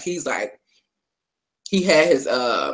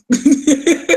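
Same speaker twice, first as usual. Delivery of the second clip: high laugh; 10 semitones higher, 4 dB louder than usual. Real, not acted. Laughing at the yes king line.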